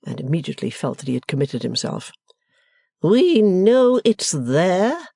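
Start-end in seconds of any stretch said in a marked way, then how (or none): 3.0-5.1 very slow, high, icy echo; glaring matron. Acted. Mother echoes the suitor's words, slow and icy. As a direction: repeat it in cold outrage. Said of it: "said Missus Wilfer, glaring".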